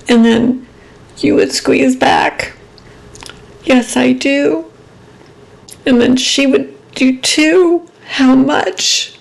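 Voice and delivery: high, tight voice on the edge of crying; holding back tears while remembering grandmother; cracking into a cry on the last words; tearful memory. Real, not acted. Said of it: "I don't wanna get emotional".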